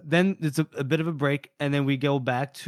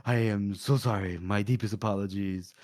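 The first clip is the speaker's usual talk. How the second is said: low, measured apology; solemn apology; 6 semitones lower, 4 dB quieter than usual. Acted. He turns to apologize to her, solemn. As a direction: drop the pitch and apologize formally. Retold character makes formal apology.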